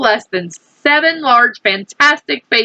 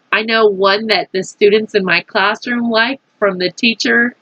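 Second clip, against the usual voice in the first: plain reading voice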